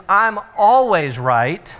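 slow, emphatic bossy declaration; mock dictator stating the rules. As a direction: lay it down slowly like a tyrant. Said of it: "The, uh, dictator attitude is".

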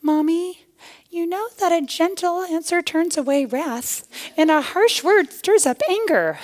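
high-pitched child imitation; sweet little daughter. Small daughter correcting her angry mommy, sweetly high. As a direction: recite it like a little cherub. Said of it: "my sweet little cherub daughter".

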